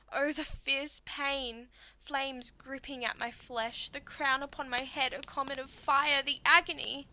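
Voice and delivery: theatrical agony, swelling in volume; agonised outcry. Acted. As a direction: cry out as if burning alive. Reliving being burned alive.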